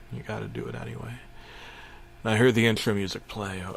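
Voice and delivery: usual speaking voice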